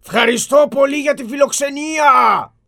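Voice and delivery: loud, high shout into the empty palace; calling out. Acted. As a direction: call your thanks to nobody in particular. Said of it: "φώναξε κι αυτός στα κουτουρού".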